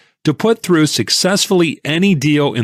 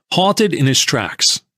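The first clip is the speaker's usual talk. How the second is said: ordinary delivery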